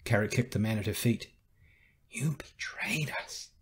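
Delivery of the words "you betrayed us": slow, soft, breath-only accusation; whispered accusation. A narrator's reading. Carrie accusing the man at her feet, slow whisper. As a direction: whisper it slowly, with weight on every word.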